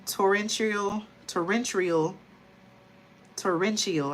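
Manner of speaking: stumbling over torrential, trying it slowly; struggling to pronounce. Real, not acted. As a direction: fumble the word torrential, unsure of each syllable. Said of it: "I can't even pronounce this word".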